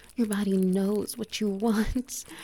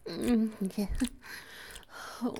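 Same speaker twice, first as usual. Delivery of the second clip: ordinary delivery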